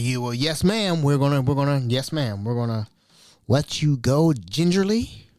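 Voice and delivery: ordinary talk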